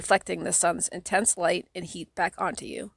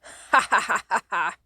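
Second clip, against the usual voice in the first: gleeful, wicked cackle, a bit louder; evil laugh; 3 dB louder than usual. A narrator's reading. Genie Jenna gloats at the trapped kid with an evil cackle. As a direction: cackle like a triumphant villain. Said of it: "a horrible, evil, hideous laugh".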